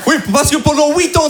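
ordinary talk

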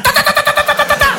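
high rapid-fire "ta-ta-ta" volley; machine-gun burst. Acted. German babysitter act punctuated for the crowd, mimicked gunfire burst. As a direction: fire off staccato gun noises on one high note. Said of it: "humour en rafale".

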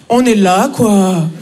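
low, falling shrug of a reply; resigned Parisian. Acted. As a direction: give a bare-minimum answer, unenthused. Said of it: "Le maximum que j'ai comme bien-être".

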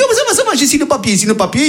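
high yelp, quickly giving in; mock pained giving in. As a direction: beaten customer surrendering. Client frappé cède. (cède en criant, voix aiguë)

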